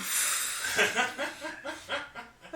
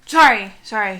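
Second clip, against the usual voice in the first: loud apology; 3 semitones lower, 13 dB louder than usual. Acted. Apologizing after slamming doors.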